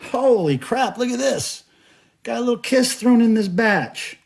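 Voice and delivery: sudden high-pitched delight at a find; excited surprise. Real, not acted. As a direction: burst out thrilled, pitch jumping up. Surprise at finding Kiss tape.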